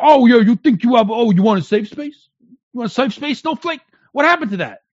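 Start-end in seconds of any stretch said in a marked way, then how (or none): 0.0-3.8 loud, higher-pitched mock jeering; mocking taunt. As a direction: jeer like you're taunting a crybaby. Acting out conservatives taunting snowflakes.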